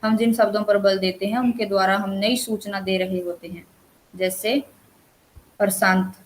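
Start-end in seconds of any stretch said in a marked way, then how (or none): none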